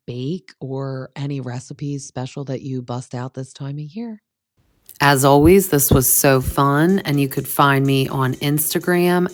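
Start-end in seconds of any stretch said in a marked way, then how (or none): 5.0-9.3 loud upbeat sign-off read; cheery announcer outro over music; podcast outro. Acted. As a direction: sell the socials like an announcer, slowing down.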